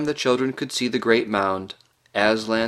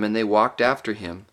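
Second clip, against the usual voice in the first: plain reading voice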